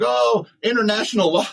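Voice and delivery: high, mocking whine of the critics; a touch of mock outrage at critics. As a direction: sneer it like a complaining critic. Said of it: "all these people crying a river is like".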